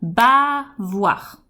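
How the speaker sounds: "bavoir" with a long, leaning ba; "bavoir" slowed, first syllable held; stressed first syllable. Shown as a demonstration. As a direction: stretch the opening ba of "bavoir". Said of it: "On va accentuer la première syllabe".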